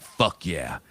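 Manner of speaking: low, soft satisfied fuck yeah; viewers cheering. Retold or imitated. Regular viewers cheer Tony on, low and soft. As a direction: mutter approval under the breath, pleased. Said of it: "And you're like".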